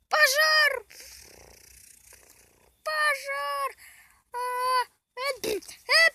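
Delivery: high panicked cry of fire; yelling in real alarm; panicked shout. Real, not acted. Fire breaks out, kids panic.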